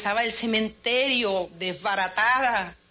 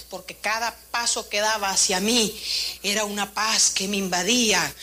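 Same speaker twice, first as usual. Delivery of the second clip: usual speaking voice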